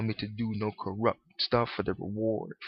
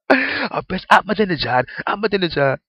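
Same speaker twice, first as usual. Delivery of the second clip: much higher, louder mock ranting; mock preacher; 9 semitones higher, 8 dB louder than usual. Still mocking the preacher, higher louder mock rant.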